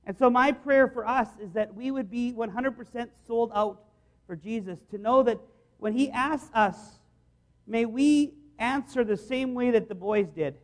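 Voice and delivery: usual speaking voice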